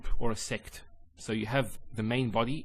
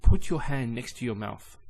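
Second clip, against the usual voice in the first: ordinary talk, nothing marked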